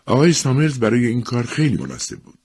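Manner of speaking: ordinary delivery, nothing marked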